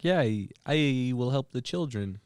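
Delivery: slow, measured drug boss promise; lightly voiced kingpin, drawn out; slow, deliberate benefactor voice; Pablo. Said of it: "Pablo, though, where he's like".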